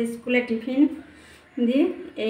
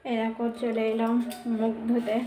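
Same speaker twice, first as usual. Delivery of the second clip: ordinary talk, nothing marked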